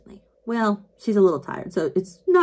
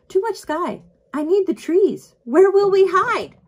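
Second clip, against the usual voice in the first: mock panic; 12 semitones higher, 6 dB louder than usual. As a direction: wail it like the sky is swallowing you. Speaker mimics own panic.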